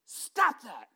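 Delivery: put-on loud, high outburst at the boys; angry dad telling the boys off; snapping scolding. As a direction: snap at your kids, loud and high. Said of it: "I'm snapping at 'em".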